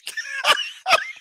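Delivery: very high mock wail; hammed-up emotional outburst. Reenacting the brother fight, high mock whimper. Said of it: "let me act on it the right way".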